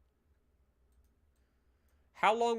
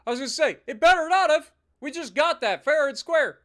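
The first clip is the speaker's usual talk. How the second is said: higher, louder huffy protest; mock outrage; 7 semitones higher, 8 dB louder than usual. Real, not acted. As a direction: defend the prize you just won. Protesting losing new territory.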